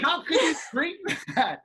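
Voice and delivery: high, incredulous, laughing through it; laughing disbelief. Retold or imitated. Friend asking about hot tea, laughing incredulously. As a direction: scold him while cracking up. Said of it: "I go".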